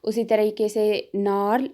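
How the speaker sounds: ordinary talk, nothing marked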